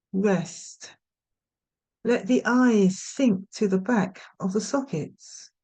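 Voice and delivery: unhurried meditation guidance with long pauses; guided meditation. Real, not acted. Yoga nidra teacher settling listeners for rest, guided meditation. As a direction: gently talk them into rest, leaving space after each instruction.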